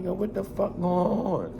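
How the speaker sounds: understated confused reaction; baffled murmur. Retold or imitated. Confused in dream.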